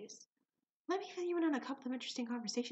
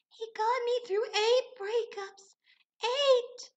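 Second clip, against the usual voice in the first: much higher, slow, mock-tearful girl voice; imitating tearful barista; 12 semitones higher, 5 dB louder, much slower than usual. Barista crying about her goldfish, high mock-tearful. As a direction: overdo the heartbroken barista.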